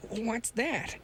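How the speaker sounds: sudden high stammered cry; startled exclaim. A narrator's reading. Scientist startled by a sound in the snow, high exclaim. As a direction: blurt it out, caught off guard. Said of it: "exclaimed the scientist suddenly".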